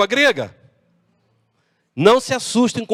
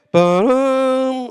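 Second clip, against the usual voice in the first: long sustained horn call; vocal trumpet fanfare, held out; imitated horn blast; 3 semitones higher than usual. Shown as a demonstration. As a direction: sound out a shofar blast. Imitates shofar being blown.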